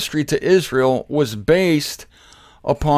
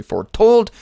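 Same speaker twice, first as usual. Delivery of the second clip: "foretold" punched higher and louder; emphasized word; 8 semitones higher, 4 dB louder than usual. Shown as a demonstration. Preacher stressing the prophecy was clearly foretold, emphatic. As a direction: hit "foretold" hard. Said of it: "I emphasize the word clearly".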